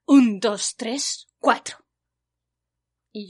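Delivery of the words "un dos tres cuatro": proud counting. Retold or imitated. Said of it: "y respondió".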